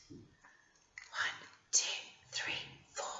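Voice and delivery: soft whispered one to four; whispered counting for the children. Shown as a demonstration.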